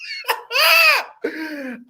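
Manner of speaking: real squealing laugh; helpless high-pitched laugh; high giggle fit. Real, not acted. I'm over here laughing.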